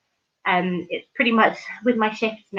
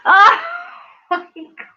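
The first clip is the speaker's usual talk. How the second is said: laughing out a loud, high oh my God; cracking up at a joke; real burst of laughter; laughing exclamation; 11 semitones higher, 8 dB louder than usual. Real, not acted. Reacting to a funny comment.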